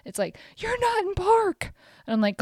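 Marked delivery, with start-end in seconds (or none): 0.6-1.7 high-pitched nagging car voice; screaming car alarm. Retold or imitated. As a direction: screech it like a scolding car horn. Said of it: "like, screaming at me".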